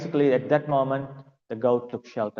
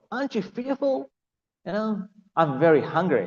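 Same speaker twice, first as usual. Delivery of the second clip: high-pitched, teasing lion's threat; hungry lion testing the goat; menacing lion; 8 semitones higher, 5 dB louder than usual. Acted. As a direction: toy with the goat before eating it.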